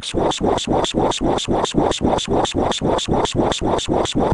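breathy unvoiced swa swa noise, rhythmic; whispered swishing noise. Narrator mimics a sound effect, unvoiced rhythmic swa. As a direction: hiss out a steady swa swa swa.

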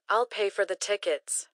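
normal talking voice

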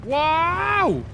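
high, loud burst of delight; thrilled yelp. Real, not acted. Player spotting another enemy, a high, loud thrilled wow.

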